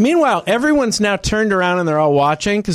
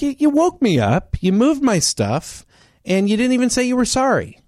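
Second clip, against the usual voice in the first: indignant complaint; 6 semitones higher than usual. Retold or imitated. Telling the seatmate off for waking him, indignant. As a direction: spell out the offense, pitch climbing.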